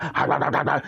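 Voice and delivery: quick string of barks; imitated dog barking. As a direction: bark repeatedly like a dog. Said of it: "He was literally barking at me".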